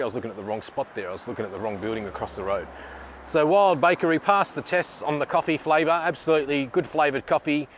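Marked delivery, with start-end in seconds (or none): 0.0-2.7 softer and faster, muttered to himself; quick quiet aside. Real, not acted. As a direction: rush it under your breath, a little sheepish. Embarrassed aside about wrong building.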